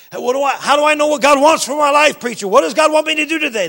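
very high, loud pleading questions; hammed-up cry of a seeker; urgent questioning churchgoer. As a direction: call out to the preacher for answers. Voices a church member questioning preacher.

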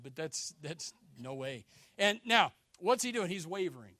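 ordinary talk

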